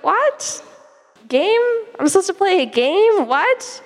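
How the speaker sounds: high-pitched baffled questioning; acted disbelief. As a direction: replay your bewilderment, pitch leaping way up. Retelling disbelief at odd instruction.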